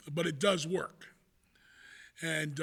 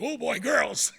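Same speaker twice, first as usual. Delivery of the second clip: put-on louder, higher burst of boyish excitement; mock excitement; 4 semitones higher, 7 dB louder, slower than usual. All-boys school wrestlers see girls come in, excited. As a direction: act out being thrilled that girls walked in. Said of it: "you get real excited".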